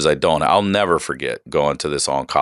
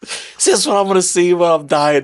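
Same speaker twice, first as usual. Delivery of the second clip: despairing inner voice; 7 semitones higher than usual. Retold or imitated. Retelling to host his thought while lying in pain, strained and high. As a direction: cry it out like a man in pain. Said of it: "I'm like".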